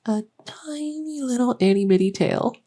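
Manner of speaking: put-on sweet baby-talk delight; cutesy cooing. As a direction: gush over something tiny and adorable. Cooing over patient's newly found tail.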